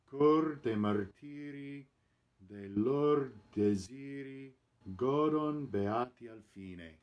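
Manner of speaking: slow, clear, GO-don stressed; model recitation. Shown as a demonstration. Coach repeating the final line with corrected stress, clear model. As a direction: recite it again with the right stress. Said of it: "The accent is on the first syllable".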